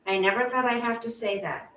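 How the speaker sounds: ordinary delivery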